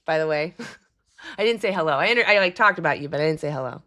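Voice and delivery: ordinary talk, nothing marked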